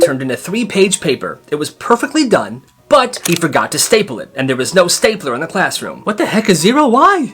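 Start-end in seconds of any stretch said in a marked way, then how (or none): 6.0-7.3 high, incredulous questioning; retold indignation, shooting up in pitch; outraged protest. Student challenging a zero over a missing staple, outraged.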